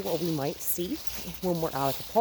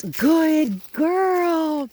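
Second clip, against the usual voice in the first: much higher and louder, slow praise; happy praise; 11 semitones higher, 8 dB louder than usual. Real, not acted. Trainer praising the puppy for chasing the toy. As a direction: cheer her on.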